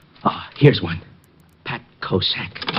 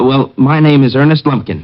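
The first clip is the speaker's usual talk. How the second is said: nervous loud; 7 dB louder than usual. Acted.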